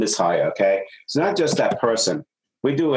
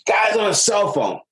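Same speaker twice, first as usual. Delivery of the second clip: upset complaint; 11 semitones higher than usual. Retold or imitated. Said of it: "came in to me so upset".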